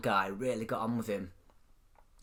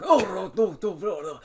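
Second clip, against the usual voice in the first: put-on Russian accent; 5 semitones higher, 5 dB louder than usual. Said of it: "this Russian guy gets plod on".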